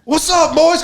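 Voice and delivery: high, hearty imitated dad hollering hello; cheery dad greeting. Dad greets his sons on the boat, high and hearty. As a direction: call out like a happy dad arriving.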